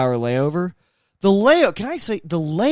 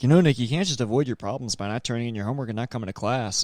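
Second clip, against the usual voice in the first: fast, quiet teacher lecture; imitating a preachy teacher; 5 semitones lower, 5 dB quieter, faster than usual. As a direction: rattle it off like a nagging teacher.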